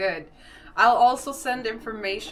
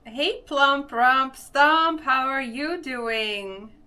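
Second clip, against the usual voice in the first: slow, high, loud sing-song greeting; cheery greeting; 5 semitones higher, 7 dB louder, much slower than usual. Real, not acted. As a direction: draw the greeting out playfully.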